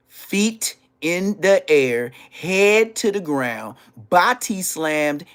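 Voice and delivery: loud, slow, each phrase hit hard; slow emphatic punch. Retold or imitated. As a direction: land each phrase like a body slam. Acting out a body slam punishment.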